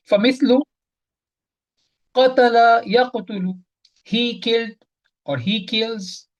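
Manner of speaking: ordinary talk, nothing marked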